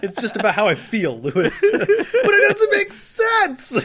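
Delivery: passionate bickering climbing to a high, loud protest; mock argument. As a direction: argue heatedly through laughter. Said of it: "when we get into an argument".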